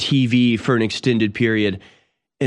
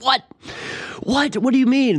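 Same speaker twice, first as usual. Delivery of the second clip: much higher, excited disbelief; kids' shock; 12 semitones higher than usual. Retold or imitated. Boys hearing what they missed on TV, high surprised yelp. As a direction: yelp it like stunned ten-year-olds. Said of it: "We were like".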